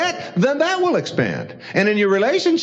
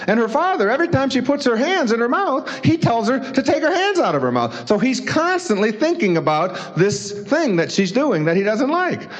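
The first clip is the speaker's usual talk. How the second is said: ordinary talk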